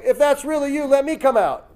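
high, keen plea; mock-excited Peter asking; eager Peter. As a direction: jump at the chance. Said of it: "He's like".

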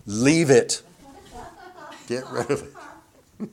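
loud firm order to yourself; firm command. Shown as a demonstration. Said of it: "do that with our own brains".

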